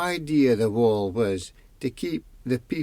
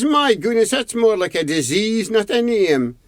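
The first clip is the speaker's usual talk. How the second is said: high, loud shocked gasp of disbelief; shocked exclamation; 10 semitones higher, 4 dB louder than usual. A narrator's reading. Husband exclaims at name.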